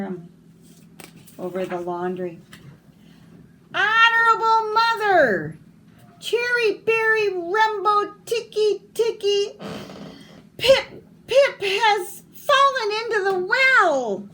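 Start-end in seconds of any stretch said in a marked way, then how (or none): 3.7-14.3 much higher, louder, tumbling words; a frantic child getting the name wrong; jumbled desperate boy. Acted. Small boy garbles his brother's name to mother, frantic and high. Chang stumbles, exhausted.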